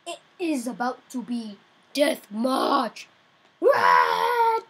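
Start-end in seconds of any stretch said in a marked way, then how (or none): 3.6-4.6 sudden high cry of disbelief; high startled what; shocked cry. Real, not acted. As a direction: yelp it out, caught off guard. Shock at sudden death in game.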